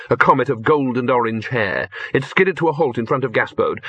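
ordinary delivery

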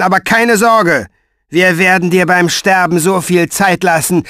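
high, loud cruel mockery; sneering villain. A narrator's reading. Pfeifer mocking Mo in chains, high and loud. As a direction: gloat over the chained man.

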